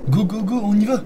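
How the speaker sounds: loud, eager rallying cry; excited shout. Real, not acted. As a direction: hype it up loudly. Excited rush to new Pringles.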